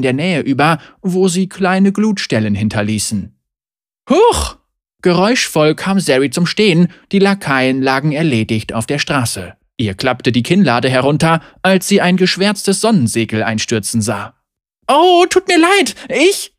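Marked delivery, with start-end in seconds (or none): 4.1-4.6 high, quick little yelp; startled yelp. Acted. Fighter startled by her own momentum, high yelp. 14.9-16.5 high, mortified apology; flustered apology. Acted. Young woman apologising for wrecking an awning, high and flustered.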